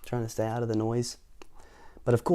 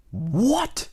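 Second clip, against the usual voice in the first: much higher shouted "What?"; loud grocer; 12 semitones higher, 3 dB louder than usual. Acted. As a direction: bark back in disbelief. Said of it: "grocer can't hear".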